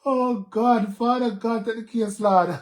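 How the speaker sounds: high laughing mock prayer; half-joking prayer. Real, not acted. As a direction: pray through laughter.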